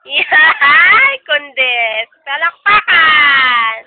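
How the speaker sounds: loud, drawn-out cheer with a laugh; big loud praise for a little one; cheering applause call. Real, not acted. Cheering on child's answer.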